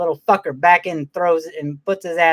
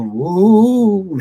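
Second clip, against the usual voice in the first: spooky ooh; 5 semitones higher, 5 dB louder than usual. Pretend. Setting the spooky mood.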